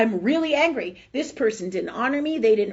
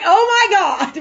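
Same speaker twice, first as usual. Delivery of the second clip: mock outburst; 8 semitones higher, 9 dB louder, slower than usual. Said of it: "And she's outside and I'm like".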